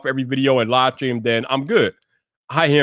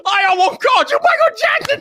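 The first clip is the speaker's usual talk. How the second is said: very high, squealed through laughter; laughing hard. Real, not acted. Streamer confessing his name slip, high laughing squeal. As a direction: barely get it out for laughing.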